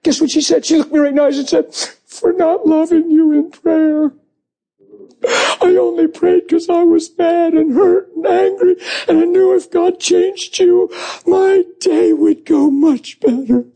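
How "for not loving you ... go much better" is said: slow, high sobbing confession; weepy wife breaking down as she confesses; imitated crying. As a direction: drag the words out through tears. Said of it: "She's bawling".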